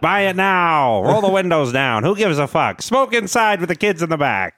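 1940s voice. Pretend. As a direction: push it like a vintage car ad. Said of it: "nineteen forties voices".

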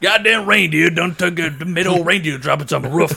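grouse like a hammed-up Southern yokel; grumbling Southern homeowner. Continues Southern roof bit.

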